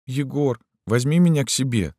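put-on high, small girlish voice; pleading girl. A narrator's reading. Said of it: "попросила Люська".

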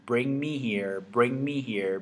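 normal talking voice

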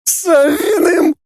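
slow, very high wail; stretched falsetto cry; pained howl. A narrator's reading. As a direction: howl the answer in agony. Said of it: "взвыл лже-Фанас".

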